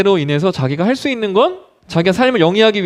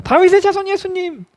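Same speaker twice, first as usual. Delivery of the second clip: acted beggar's desperate shout; more desperate calling; 13 semitones higher than usual. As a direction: call out to Jesus with everything, pitched very high. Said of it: "더 간절하게 예수님을 부릅니다".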